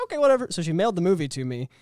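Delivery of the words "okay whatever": high, loud mock shrug; dismissive. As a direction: toss it off like you don't care. Retold own reply, high loud.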